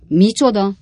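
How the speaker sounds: loud, high cry of disbelief; exclaiming. A narrator's reading. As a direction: burst out in shock. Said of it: "kiáltott fel Bob Sawyer úr". (felkiáltva, döbbenten)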